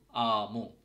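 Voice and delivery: "আমু" very slowly, open আ held long; slow demo of "আমু" with a long aa; Finnish A sound. Shown as a demonstration. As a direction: stretch "আমু" out for learners. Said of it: "এইটা normally আর মতো উচ্চারণ হচ্ছে".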